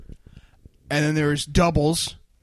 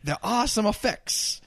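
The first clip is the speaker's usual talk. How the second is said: Cat Williams impression; 6 semitones higher than usual. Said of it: "Is that Cat Williams?".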